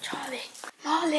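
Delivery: usual speaking voice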